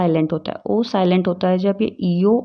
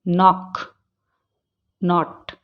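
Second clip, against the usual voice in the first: ordinary talk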